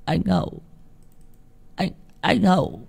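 delirious mumbling. A narrator's reading. Boy alone at home pleads in delirium, delirious mumbling. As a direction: start faint and broken, build to a frightened cry. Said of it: "thằng Tèo cứ nằm lẩm bẩm".

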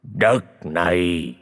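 slow, drawn-out menacing spirit voice; a vengeful ghost growling from beyond, very slow; low murky ghost voice. Acted. Vengeful spirit threatens the dying husband, slow menacing voice. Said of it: "một giọng nói trầm đục".

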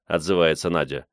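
ordinary delivery, nothing marked

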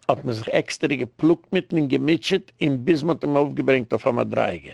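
ordinary talk, nothing marked

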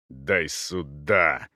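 low, very slow order; grim command. Acted. Cavalier wrenches a sword from a man, grim command. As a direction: drag out the words with effort. Volkov wrenches weapon away.

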